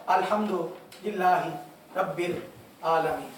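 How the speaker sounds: अल्हम्दुलिल्लाहि, रब्बिल, आलमीन dropped in low separate pieces; broken-up reading. Shown as a demonstration. Teacher showing how not to recite, pauses after अल्हम्दुलिल्लाहि and रब्बिल. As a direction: stop after अल्हम्दुलिल्लाहि and after रब्बिल, letting each fall. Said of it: "यूँ नहीं पढ़ना".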